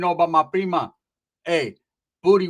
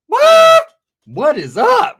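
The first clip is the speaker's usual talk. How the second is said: much higher, loud squealed what; hyped shout; 12 dB louder, much slower than usual. Retold or imitated. Greeting the girl, high loud hyped shout. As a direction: yell it like a hype man.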